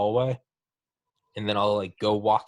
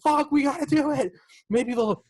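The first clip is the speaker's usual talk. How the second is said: high, frantic mock panic; a hammed-up terrified soldier; panicked. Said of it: "And it's like".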